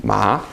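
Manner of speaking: MA sliding upward from low; rising tone. Shown as a demonstration. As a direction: lift MA like a surprised question. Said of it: "Your voice goes up".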